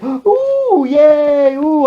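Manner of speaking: very high, loud whooping; squealed mock cheers; mock family cheering. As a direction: imitate an over-impressed crowd in falsetto. Whole family cheering the fire dancer.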